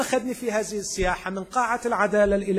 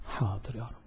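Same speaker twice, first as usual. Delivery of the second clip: low, very soft reply with a lift; hushed, humble assent; meek obedience; 6 semitones lower, 8 dB quieter than usual. Acted. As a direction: answer submissively, under your voice. Acted meek reply to God.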